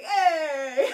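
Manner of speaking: high, loud hollered "hey"; imitated shout. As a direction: holler it like the old relative. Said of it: "He was like".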